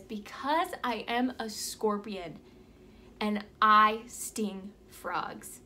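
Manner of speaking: very slow, weighty storyteller's punchline; deliberate scorpion. Acted. Scorpion answers dying frog, slow and matter-of-fact. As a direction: spell it out slowly, pausing midway. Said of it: "he says".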